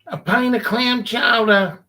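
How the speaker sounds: put-on high, slow, loud old lady voice; a loud old woman's put-on voice; old lady order. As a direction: mimic the old lady ordering slowly and loudly. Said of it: "and she'd say".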